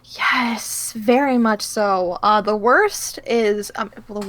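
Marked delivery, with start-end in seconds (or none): none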